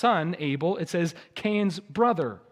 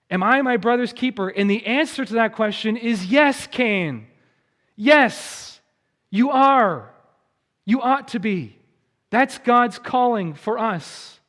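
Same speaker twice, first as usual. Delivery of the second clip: louder, higher emphatic preacher; impassioned preaching; 6 semitones higher, 7 dB louder than usual. Real, not acted. Pastor answering Cain's question for the congregation, emphatic. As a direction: proclaim it with rising conviction, landing each phrase.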